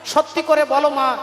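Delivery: ordinary delivery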